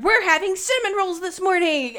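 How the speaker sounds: high, sing-out morning excitement; giddy announcement. Retold or imitated. Acting out herself leaping out of bed, giddy and excited. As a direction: announce it like a thrilled kid.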